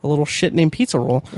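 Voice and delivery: ordinary talk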